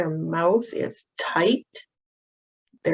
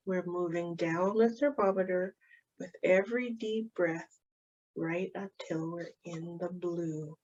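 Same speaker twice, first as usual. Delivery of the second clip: put-on softer, soothing voice leading a child through calming down; guided calming; 7 dB quieter than usual. Shown as a demonstration. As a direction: talk the child gently back down the thermometer. Leads a calming exercise.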